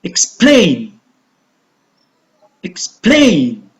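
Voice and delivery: Explain, ex-PLAIN, careful teaching demo; stressed second syllable. Shown as a demonstration. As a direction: Explain with PLAIN long and falling. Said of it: "going to pronounce louder and longer".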